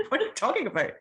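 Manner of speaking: husband reining in an impulsive wife; imitating husband. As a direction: play the sensible husband, amused. Voicing husband's objection.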